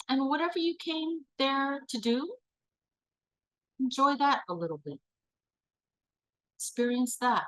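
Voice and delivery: calm facilitator voice; guided visualization. Acted. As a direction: lead the group through a daydream, gently.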